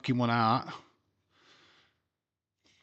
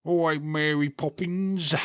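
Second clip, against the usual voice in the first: hammed-up fake cockney accent, higher and louder; a cheeky cartoon cockney accent, overdone; mock cockney accent; 5 semitones higher, 6 dB louder than usual.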